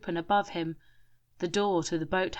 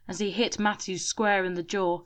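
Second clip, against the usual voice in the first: plain reading voice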